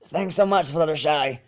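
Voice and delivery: plain reading voice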